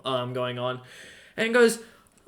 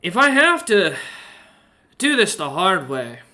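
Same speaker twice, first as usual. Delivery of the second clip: menacing; 4 semitones higher, 5 dB louder than usual. Acted. Merchant threatens.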